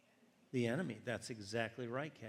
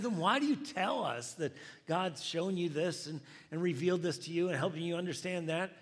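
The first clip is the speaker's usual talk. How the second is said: loud, higher-pitched challenging question; frustrated challenge; 6 semitones higher, 7 dB louder than usual. Retold or imitated. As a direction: press her with retold exasperation. Retold frustrated questioning of the lady.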